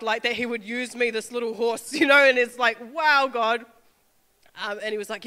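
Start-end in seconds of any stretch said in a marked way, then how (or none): 2.9-3.6 in awe. Real, not acted. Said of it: "I'm in awe of God".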